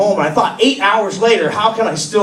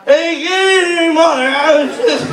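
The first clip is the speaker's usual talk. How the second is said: higher, overly formal greeting; fake polite son; 9 semitones higher than usual. Drunk son greeting his mom, overly polite. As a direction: pretend to be sober and proper. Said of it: "you're like".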